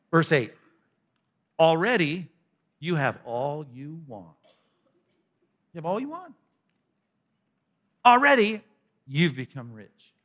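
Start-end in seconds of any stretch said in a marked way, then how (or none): 1.6-4.4 slow, drawn-out sarcasm; sarcastic, dropping away slowly. Acted. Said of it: "All that sarcasm". 5.7-6.3 high, soft mock disbelief; sarcastic marvelling, pitched up. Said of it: "All that sarcasm". 8.0-10.1 mock amazement, heavy irony; sarcastic. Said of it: "All that sarcasm".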